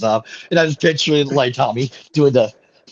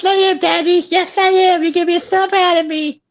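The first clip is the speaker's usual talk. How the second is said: high put-on voice. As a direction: squeal it in a silly voice.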